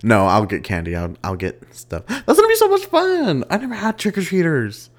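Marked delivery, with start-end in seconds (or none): 2.1-4.9 squealing high and loud; bursting with glee about Halloween; excited. Real, not acted. Excited about trick-or-treaters.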